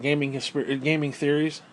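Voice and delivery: ordinary talk, nothing marked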